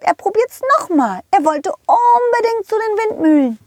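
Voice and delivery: squeaky high cartoon horse calling out. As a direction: play a cheerful high-pitched pony. Rosa the horse tells story. (hohe quietschige Pferdestimme)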